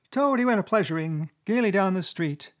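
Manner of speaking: high-pitched weasel starting a taunting ditty; high squeaky voice. A narrator's reading. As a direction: recite the song in a high squeak. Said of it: "began in a high, squeaky voice".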